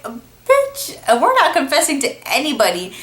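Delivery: shrill high bitch, then a fast sassy snap; playfully bossy no way; sassy refusal. Retold or imitated. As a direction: shut the idea down with attitude. Arguing with Sue over confessing.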